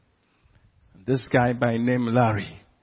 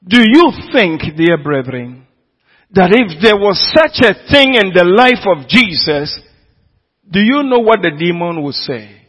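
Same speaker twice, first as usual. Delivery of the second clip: louder, higher pulpit build; preaching at full force; 5 semitones higher, 9 dB louder than usual. Acted. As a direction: rouse the congregation with each question.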